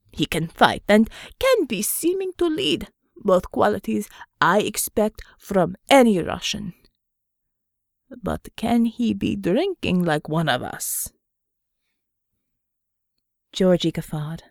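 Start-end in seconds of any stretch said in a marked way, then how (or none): 0.1-11.1 put-on Russian accent. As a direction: lean into a thick Russian accent. Pavel asks about drinking.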